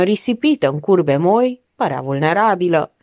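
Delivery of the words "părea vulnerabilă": usual speaking voice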